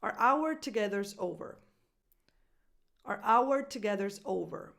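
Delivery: "Our" as a quick "ar", then a loud full "hour"; weak "Our", punch "hour" as "ow-er"; reduced our beside hour. Shown as a demonstration. Coach putting both words side by side, reduced our. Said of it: "putting them next to each other".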